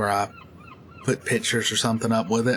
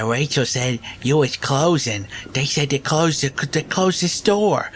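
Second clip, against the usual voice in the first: crazy prank caller voice; 7 semitones higher than usual. Retold or imitated. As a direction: play it dumb and odd on the phone. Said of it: "do crazy sounding stuff".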